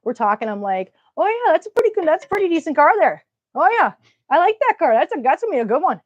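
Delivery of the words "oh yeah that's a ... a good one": put-on high, sing-song Minnesota accent, fast at the end; watching a restoration show with dad, hammed-up Minnesota accent. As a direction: chat about a car in a broad Minnesota accent. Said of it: "biggest accent, Minnesota accent".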